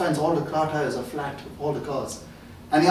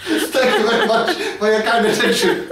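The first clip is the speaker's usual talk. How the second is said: laughing; 5 semitones higher than usual. Real, not acted. Laughing through the sign-off.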